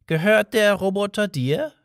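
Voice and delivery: lightly voiced friendly old man, high. A narrator's reading. Old man kindly answering Arthur, lightly voiced high.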